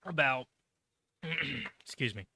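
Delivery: clearing throat after choking on water; coughing up water that went down wrong. Real, not acted. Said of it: "Sorry, choking on water there".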